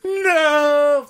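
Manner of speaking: high fake cry; a hammed-up wail; mock wail. Reacting to pubes in chili.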